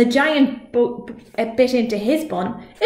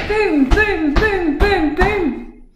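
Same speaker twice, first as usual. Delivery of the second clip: high, loud booming; louder booming footsteps; 9 semitones higher, 5 dB louder, much slower than usual. A narrator's reading. Narrator booms the nearing giant's steps. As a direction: pound out each boom.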